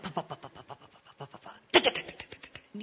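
very soft voiceless popping pulses; tiny rapid lip and tongue pops; imitated sound effect. Speaker mimicking time freezing in The Matrix, sound effect. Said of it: "it's all of a sudden like".

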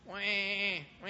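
mimicking a baby crying in a silent theater; baby cry imitation. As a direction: wail it like a fussy infant.

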